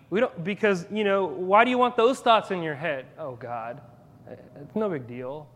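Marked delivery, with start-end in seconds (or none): 3.2-5.5 mumbled, much softer eye-rolling brush-off; whiny dismissive mock. Pastor mimics churchgoers waving off God's commands, softer and whiny. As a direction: sigh it off like a bored teen. Mocking people who dismiss God.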